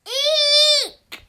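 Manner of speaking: high, loud startled shriek; lion's frightened eek. Acted. The lion sees the mouse and shrieks, high and loud. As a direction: squeal like a scared lion.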